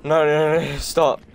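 fast panicked high repeats; panicking gamer. Real, not acted. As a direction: beg the screen in a panic. Panic as enemy approaches.

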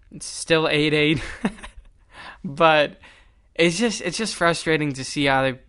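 ordinary talk

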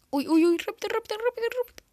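hurry, hurry, panicking; panicked hurry. Real, not acted.

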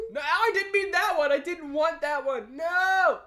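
panicked yelling. Real, not acted. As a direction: shout in panic at the wrong choice. Misclicked a game option.

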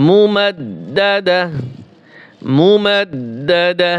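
মুমাদ্দাদা slowly, held dd and a breathed "dah" ending; pausal ending. Shown as a demonstration. Teacher showing the word at waqf, slow h ending. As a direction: stop slowly on মুমাদ্দাদা with no -tin. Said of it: "ওয়াকফের সময় উচ্চারণ হবে".

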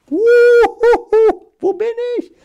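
high, hooting ghost calling from nowhere; spooky ghost voice. Pretend. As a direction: play the vanished letter as a little ghost. (hohe Gespensterstimme)

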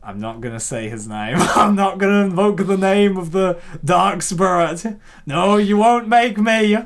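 normal talking voice

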